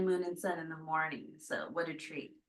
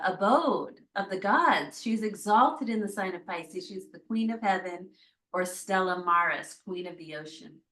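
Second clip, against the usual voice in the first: usual speaking voice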